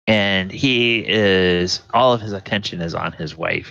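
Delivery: normal talking voice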